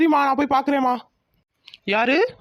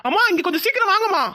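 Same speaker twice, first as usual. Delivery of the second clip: higher, urgent call for mother; calling out urgently; 7 semitones higher than usual. Acted. Son summons mother to the doorway, urgent call. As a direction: call her in from the next room, fast.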